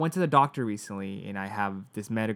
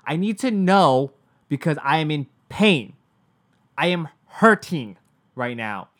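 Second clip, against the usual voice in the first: retelling her outburst, much louder, slowing on I am hurting right now; re-shout it in pain at the front desk; loud retold outburst; 3 semitones higher, 8 dB louder than usual. Patient in pain telling the receptionist she is hurting, in a loud retold outburst. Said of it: "And I said it so loud".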